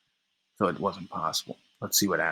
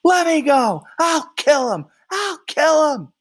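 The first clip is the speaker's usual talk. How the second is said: loud, much higher screams while held back; screaming; 6 dB louder than usual. Acted. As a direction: scream and struggle against the men holding you. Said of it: "Then he screams".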